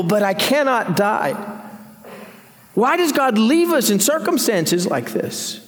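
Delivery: lightly voiced anguished Job; voicing Job's questions. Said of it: "he says".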